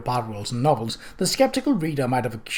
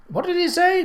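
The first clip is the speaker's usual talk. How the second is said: high call over the engine roar; crying out over noise. Acted. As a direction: shout the question to be heard. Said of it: "Patsy cried over the noise of the engine".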